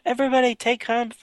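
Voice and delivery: high, rushed mock yell; imitated yelling. Officer warning the crew after beaming down, mock urgent yell. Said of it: "she's, like, yelling at everybody".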